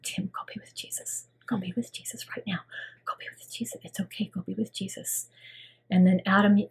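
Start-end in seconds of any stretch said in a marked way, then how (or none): 0.0-5.3 soft, hushed whisper to a dying husband; whispered farewell. Retold or imitated. Wife at her husband's deathbed, whispering softly. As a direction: whisper him gently toward heaven. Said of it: "I reached down and I said".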